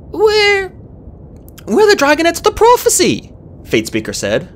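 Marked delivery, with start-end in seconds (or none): 0.1-3.3 very high, squeaky voice; falter, then blurt it in a high voice; anxious squeaky introduction; nervous young dragon. A narrator's reading. Frightened Fatespeaker introduces them to queen.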